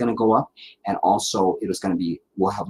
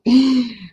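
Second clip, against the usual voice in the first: high, loud burst of laughter; 7 dB louder than usual. Real, not acted. Amused by viewer's accent question.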